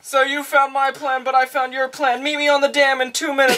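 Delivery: high, breezy phone call; mock cheery villain. Villain's phone call, played for laughs.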